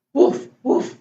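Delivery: short imitation woofs; quick, playful mock barking; puppy bark. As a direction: bark like a little dog. Story reader barks as puppy Biscuit.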